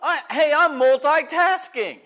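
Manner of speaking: telling the congregation he's juggling it all, hammed-up brag; mock proud boast. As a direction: show off your multitasking, hammed up. Boasting about multitasking while driving.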